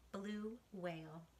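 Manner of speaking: ordinary delivery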